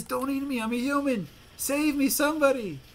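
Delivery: high-pitched mock cry for help; panicked plea. Man in gorilla suit begging the lion, panicked. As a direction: plead for your life, hammed-up, in a high voice. Actor pleads before a lion.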